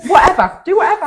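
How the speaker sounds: handing over the mic with a teasing, loud "whatever"; mock dismissive. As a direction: toss it off in mock exasperation. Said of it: "Do whatever he wants to do".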